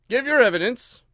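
loud, high angry order. A narrator's reading. King repeats his order to the Hatter, angry and loud. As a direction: demand it in anger. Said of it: "the king repeated angrily".

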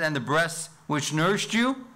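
a woman in the crowd blesses Jesus's mother, exclaimed; exclaimed quote. Shown as a demonstration. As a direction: call it out as she did from the crowd. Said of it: "notice the emphasis here".

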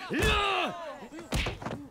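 high leering laugh; lecherous laugh. Acted. Drunk official carousing.

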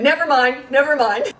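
imitating a kid backing down, high and loud; hasty backing down. Son hurriedly retracting to his mother, mock alarm.

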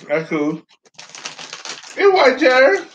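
chewing while talking; laughing with mouth full; mouth full of chips. Real, not acted. Seen 1: Man bites chip at 0.8, cheeks full chewing through 5.1.

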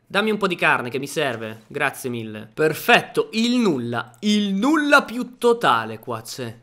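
ordinary delivery

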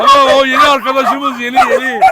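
excited greeting. Real, not acted. Greeting new dog excitedly.

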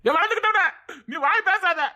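very high, irritated yelp; sharp high-pitched telling-off; exasperated outburst. Acted. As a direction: shut the friend up in a high voice. Orders friend to shut up.

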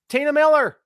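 high, loud name call; big hammed-up guest announcement; announcer name call.

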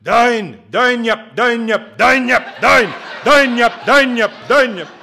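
Scottish guitar teacher shouting the strumming rhythm; imitating Scottish teacher's yell. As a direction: yell it like a Scottish music teacher in a thick brogue. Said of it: "with a thick Scottish accent, he would yell at us".

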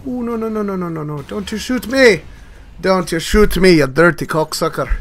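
loud, high, begging in a put-on voice; panicked put-on yell. Gamer begging a game enemy not to shoot, loud mock panic.

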